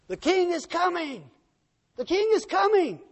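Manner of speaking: high herald's cry, moderately loud; distant marchers yelling. Acted. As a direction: call out as an approaching army. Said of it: "as it got louder and louder".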